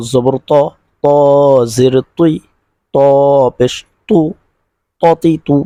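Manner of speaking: slow, rising call of each syllable; recitation drill. Shown as a demonstration. Teacher reciting letters to students, slow chant.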